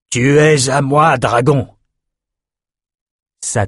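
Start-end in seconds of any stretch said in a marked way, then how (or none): 0.1-1.7 lent, haut, il ordonne fort; ton impérieux. Acted. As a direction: clame sa possession. Outros somme le dragon. (voix impérieuse, haute et lente)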